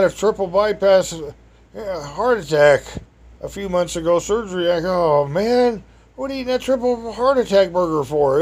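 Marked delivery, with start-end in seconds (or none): 5.2-8.4 higher, disbelieving scold; can't believe what he's eating; incredulous. Retold or imitated. Disbelief at the sick man's burger.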